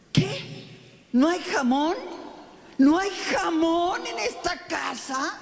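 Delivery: a grown man whining about ham; whiny husband. Retold or imitated. Husband sulking that there is no ham at home.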